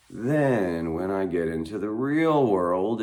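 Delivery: ordinary talk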